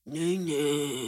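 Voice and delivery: put-on drawn-out drunken call, falling at the end; imitating drunk self. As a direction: imitate yourself drunkenly calling a friend. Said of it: "huske den der stemme".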